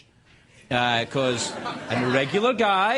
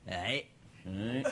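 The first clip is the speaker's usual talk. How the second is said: very soft, low grunted reply; terse Scottish men; 8 semitones lower, 12 dB quieter than usual. Retold or imitated. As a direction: barely say it, like taciturn father and son. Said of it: "life in true Scottish fashion".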